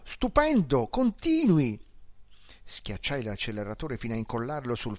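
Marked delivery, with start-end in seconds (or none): 0.0-1.9 shouted joy at the speed; gleeful shout from the passenger seat; excited shout. A narrator's reading. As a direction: yell it out thrilled, stretched slow. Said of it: "gridò".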